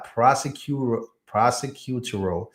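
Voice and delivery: slow, careful syllable-by-syllable prosecutorial; labored attempt at prosecutorial; struggling pronunciation. Real, not acted. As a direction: sound out prosecutorial slowly, stumbling. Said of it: "I can't say these words".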